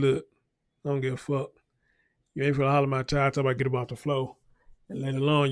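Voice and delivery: usual speaking voice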